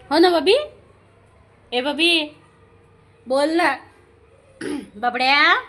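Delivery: high, insistent coaxing; calling a pet. Real, not acted. Urging the pets to respond.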